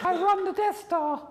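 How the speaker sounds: comically high, squeaky villain; Darth Vader. Acted. Coach spoofs a Star Wars villain, high and squeaky. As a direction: squeak the menace away. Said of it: "Darth Vader's voice was a little bit different".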